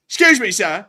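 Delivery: put-on loud, high British-accented call; hammed-up British interruption. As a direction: holler for attention in a British character voice. Calls out as the British marmot.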